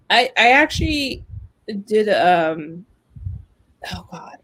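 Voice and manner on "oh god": whispered aside. Real, not acted. Embarrassed aside mid-story.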